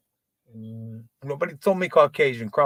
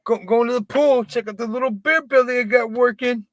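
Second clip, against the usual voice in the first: higher, showy mocking bro voice; higher mock bro bragging at the pool; 7 semitones higher than usual. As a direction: lampoon a proud dad-bod bro. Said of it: "dudes today talking about their dad bods".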